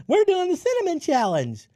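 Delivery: high-pitched mock kid voice; imitated teenage daughter. Dad imitating his daughter announcing her stunt, high mock-teen voice. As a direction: mimic an excited teen girl, pitched right up.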